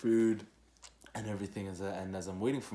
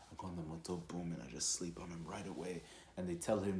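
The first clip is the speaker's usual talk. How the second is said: normal talking voice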